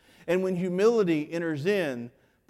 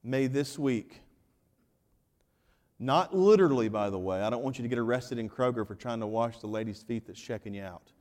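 ordinary talk

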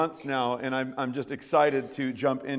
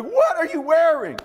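much higher and louder, stunned; incredulous; 12 semitones higher, 8 dB louder than usual. Retold or imitated. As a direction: react in stunned disbelief. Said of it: "And I'm like".

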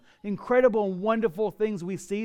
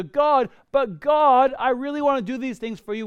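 whiny pleading; 7 semitones higher, 6 dB louder than usual. Retold or imitated. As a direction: beg like a sulking child. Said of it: "we go".

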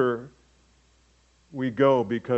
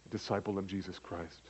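much softer, lower a disciple of Jesus Christ; tender close to the dads' question; hushed; 5 semitones lower, 14 dB quieter than usual. Shown as a demonstration. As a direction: let it fall to a near-hush. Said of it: "primary role in your home".

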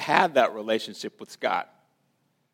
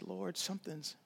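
soft, much quieter plea to God; quiet, searching prayer; hushed prayer; 7 semitones higher, 17 dB quieter than usual. Retold or imitated. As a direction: pray it under your breath.

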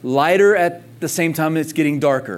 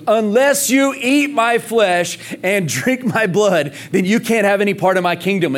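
high, slow, solemn pronouncement; Jesus declaring; 7 semitones higher, 4 dB louder than usual. Retold or imitated. Jesus telling the crowd a hard saying, slow raised proclamation. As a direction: hand down the hard teaching like a verdict. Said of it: "Jesus goes".

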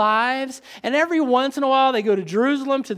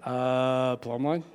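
lower, slow, dim-witted drawl of a puzzled man; dopey mock Amos; 9 semitones lower, 5 dB quieter, slower than usual. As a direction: play Amos as a clueless guy guessing. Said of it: "That, that's how he said it".

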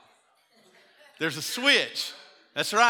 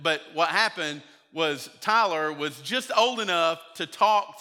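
normal talking voice

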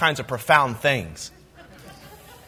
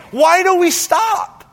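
loud, slow, high climax; preaching shout; 10 semitones higher, 3 dB louder, slower than usual. Real, not acted. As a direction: cry it out slowly to the room.

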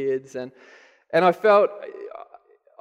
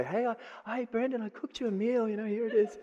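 embarrassed; 6 semitones higher, 8 dB quieter than usual. Retold or imitated. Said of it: "it's embarrassing to say".

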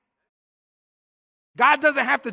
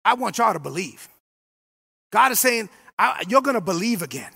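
lowered, gentle voice of God; God speaking gently; 5 semitones lower than usual. Retold or imitated. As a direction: speak it low like God reassuring. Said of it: "God's saying".

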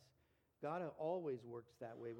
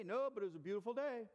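evasive fisherman; 6 semitones higher, 6 dB louder than usual. Retold or imitated. Acted fisherman's diversion.

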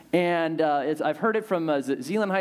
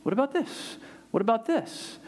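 playful invitation; 5 semitones higher than usual. Retold or imitated. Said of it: "God breaks in and says".

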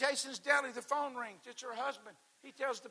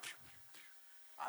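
slow whispered zap sounds; 18 dB quieter, much slower than usual. Retold or imitated. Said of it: "I'd be like".

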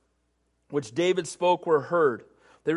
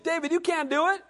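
a hint of a scoffing Saul, higher; dismissive king; 9 semitones higher than usual. Mild scoffing dismissal. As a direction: Saul telling David he can't fight.

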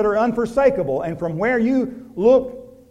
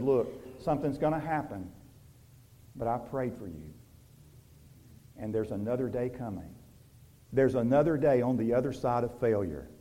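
hushed, low, gentle promise; Jesus softly reassuring the one who failed; tender reassurance; 8 semitones lower, 10 dB quieter than usual. Acted. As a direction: comfort a friend who has failed.

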